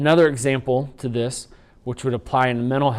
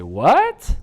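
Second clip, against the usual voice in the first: "What?" pitched higher with a sharp rise; rhetorical question; 5 semitones higher, 5 dB louder than usual. Shown as a demonstration. Teacher demonstrates rhetorical question tone on "What?". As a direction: throw "What?" up high, disbelieving. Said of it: "I may say".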